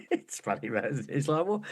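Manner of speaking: ordinary talk, nothing marked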